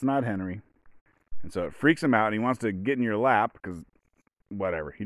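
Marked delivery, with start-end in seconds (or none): none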